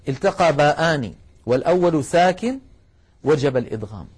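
ordinary delivery

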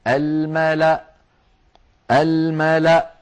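الملأ cut off on a glottal stop; الملأ chanted, ending on a vowelless hamza; stop on silent hamza. Shown as a demonstration. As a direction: halt الملأ at the hamza, no waw or alif after it. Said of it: "ويوقف عليها بهمزة ساكنة".

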